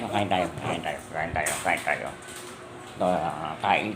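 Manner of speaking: low, soft sing-over repetition; calming. Real, not acted. Promising the pet they will eat, calming. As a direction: repeat it low and softly.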